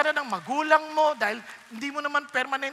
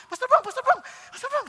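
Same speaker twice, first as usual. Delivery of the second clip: high, loud calling after someone; 10 semitones higher, 5 dB louder than usual. Retold or imitated. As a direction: call him from behind.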